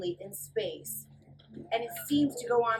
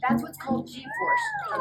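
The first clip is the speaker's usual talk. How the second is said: much higher, louder, pressed voice; strained high voice; 8 dB louder than usual. Acted. Fly pinned in seat on reentry, strained high voice. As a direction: squeeze it out like g-force pins you.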